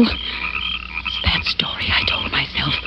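whisper. Acted. Scolding herself after a scare.

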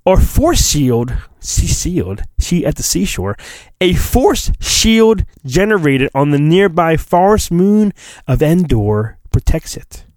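normal talking voice

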